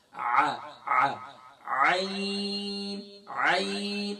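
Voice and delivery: "عین" drawn out from the middle of the throat; short "آ", then long held "عین"; mid-throat ain. Shown as a demonstration. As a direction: model "عین" slowly with an easy pharyngeal onset. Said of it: "گلے کے middle سے ہوتی ہے".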